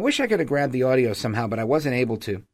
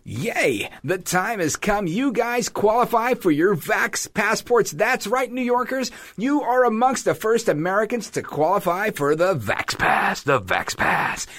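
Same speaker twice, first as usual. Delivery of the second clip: high, drawn-out promo voice; hammed-up announcer celebrating big news; mock radio announcer; 7 semitones higher, slower than usual. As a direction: hype it up like a gushing radio ad. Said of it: "fancy radio announcer that comes out".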